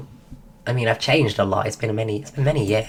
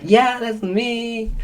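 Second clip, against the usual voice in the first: awkward high reply; 9 semitones higher, slower than usual. Retold or imitated.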